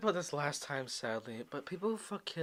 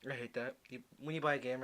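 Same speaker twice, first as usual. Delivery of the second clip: ordinary talk, nothing marked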